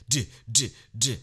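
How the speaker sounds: mock thumping beat sounds; beat imitation. Shown as a demonstration. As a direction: tap out the beat with your voice. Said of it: "the beat is just going".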